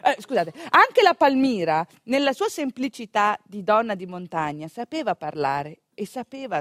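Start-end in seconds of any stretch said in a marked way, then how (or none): none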